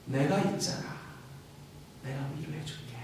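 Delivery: Jesus softly consoling the one in tears; tender comfort. Acted. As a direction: gently comfort a weeping child. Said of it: "예수님은 이렇게 말씀하시는 겁니다".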